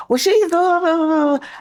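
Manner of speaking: imitating a whiny son; mocking whiny child. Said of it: "He's like".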